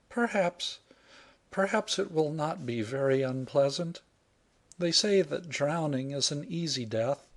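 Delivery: brave little acceptance of fate; resigned heroine. Acted. Heroine's voice held.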